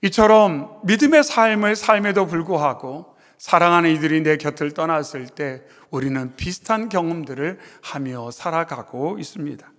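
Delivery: ordinary talk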